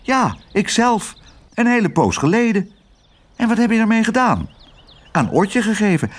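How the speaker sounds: high-pitched, lively exchange; animated character dialogue. A narrator's reading. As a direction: voice it high and eager. Animated dialogue in the read story.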